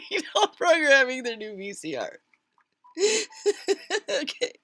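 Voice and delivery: talking through laughter; amused, laughing aside. Real, not acted. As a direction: giggle the words out. Laughs around the line.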